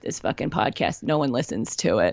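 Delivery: low, scornful inner critic voice; Bitchy Brenda. Retold or imitated. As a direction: sneer it like a cruel inner voice. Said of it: "your Bitchy Brenda may sound different".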